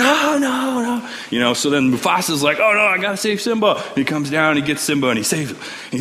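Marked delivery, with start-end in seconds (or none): 0.0-1.1 high-pitched mock cry of alarm; mock panic. Simba sees the wildebeest coming, mock panic. 2.6-3.8 high, urgent storyteller's alarm; mock alarm. Mufasa rushing to save Simba, mock alarm.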